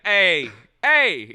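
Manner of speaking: loud hey-hey catcall. Pretend. Wiseguy catcalling a painting in the hallway, loud mock catcall.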